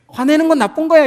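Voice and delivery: high, loud scolding parent; a parent snapping that anger is bad.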